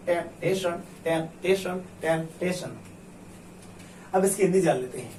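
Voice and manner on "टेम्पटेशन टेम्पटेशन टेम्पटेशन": normal talking voice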